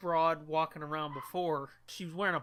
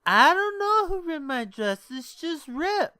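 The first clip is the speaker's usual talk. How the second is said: much higher, louder wail; whiny drunk girl; 9 semitones higher, 8 dB louder than usual. Retold or imitated. Drunk girlfriend wailing to boyfriend about her ripped dress.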